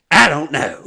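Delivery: loud, high comic outburst; mock exclamation. Comedian answering a woman at the table, loud baffled outburst. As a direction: yell it in baffled disbelief.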